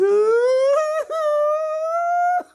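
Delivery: very high, loud shrieking laugh-cry; inhuman shriek laugh. Retold or imitated. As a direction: imitate a wild, inhuman squeal of laughter. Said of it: "a sound came out of his girlfriend that just wasn't human".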